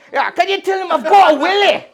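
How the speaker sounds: loud, high shouted Scottish voice; Scottish stripper. Retold or imitated. Scottish stripper shouts back at the friend, loud Scots voice. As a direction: bellow it in a thick Scots accent. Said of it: "He goes".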